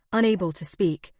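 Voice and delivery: plain reading voice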